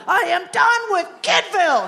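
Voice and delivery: high toddler yell, lightly voiced. Mom imitating her son's high mock yell at the play space. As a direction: shout it like a fed-up little boy. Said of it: "yelled at the top of his lungs".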